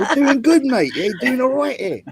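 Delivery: high, hammed-up British bloke voice; mock British accent. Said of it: "My, my accent is horrible".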